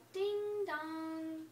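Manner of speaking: usual speaking voice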